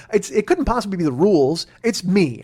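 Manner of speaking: ordinary delivery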